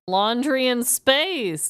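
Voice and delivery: normal talking voice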